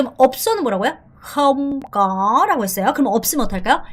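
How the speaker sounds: normal talking voice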